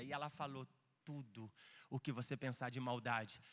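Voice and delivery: ordinary delivery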